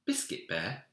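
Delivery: plain reading voice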